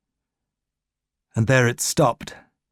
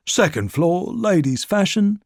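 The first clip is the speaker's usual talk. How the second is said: higher, sing-song floor call; lift operator calling out; 6 semitones higher than usual. A narrator's reading. Lift operator announcing to shoppers, higher call. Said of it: "The lift operator called out".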